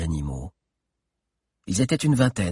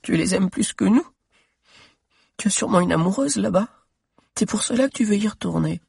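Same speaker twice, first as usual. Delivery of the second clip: voix d'enfant très aiguë, en pleurs; petite fille qui pleure; 11 semitones higher, faster than usual. Acted. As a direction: une fillette jalouse et triste. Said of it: "Elle aussi pleurait". (la fillette reproche à Zizo, voix de pleurs aiguë)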